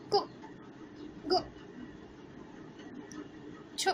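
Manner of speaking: को गो छु said short and light; short u sounds. Shown as a demonstration. Teacher to class, showing short उ syllables. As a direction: tap out को गो छु as quick short syllables. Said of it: "ओ ऐसे निकालते हैं".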